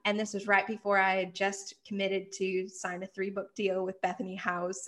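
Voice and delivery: ordinary delivery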